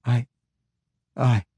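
halting, unsure stammer. Acted. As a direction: stumble over the words. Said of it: "Kjorl stammered".

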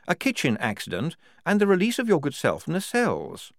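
high, articulate voice addressing a superior; earnest subordinate explaining. Acted. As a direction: explain it formally, pitched well up. Junior voices explanation to officer.

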